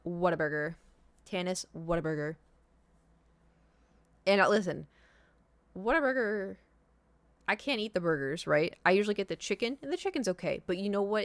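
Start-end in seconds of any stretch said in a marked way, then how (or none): none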